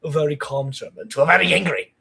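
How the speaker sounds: German accent building from calm to a mock-angry burst. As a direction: start composed, flare up in a German accent. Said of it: "will get angry and stuff".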